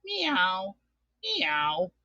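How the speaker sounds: quiet little cat meows; soft meow. Pretend. Host asks the sound a cat makes, soft meowing. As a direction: mew softly like a cat. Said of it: "what sound does a cat make".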